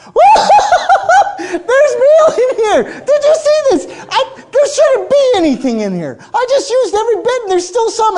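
a woman overjoyed at the flour jar; excited Baptist. Acted. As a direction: squeal with joyful disbelief. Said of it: "excited Baptist".

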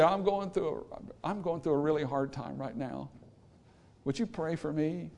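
quiet, pitiful asking for prayer; soft plea. Retold or imitated. A struggling person asks a friend for prayer, softly. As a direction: murmur it like someone always needing help.

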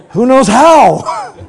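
loud, high, slow laughing question; laughing aside. Real, not acted.

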